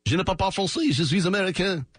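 high-pitched put-on French accent; hammed-up French, pitched well up. Shown as a demonstration. Host showing his failed Cajun attempt, high French accent. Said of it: "I was just doing a French accent".

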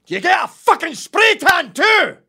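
furious high Scottish shout; screamed Scottish outburst; Scottish accent. Acted. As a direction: blow up at them in Scottish. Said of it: "I broke character, but I kept it Scottish".

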